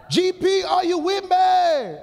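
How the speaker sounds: high, loud call to the crowd, drawn out; shouted song call. Retold or imitated. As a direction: belt it like a gospel call-out. Calling out [name] song line.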